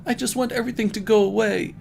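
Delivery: high, fast, teary rush; holding back tears. A narrator's reading. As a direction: blurt it out, fighting off tears. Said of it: "trying not to cry".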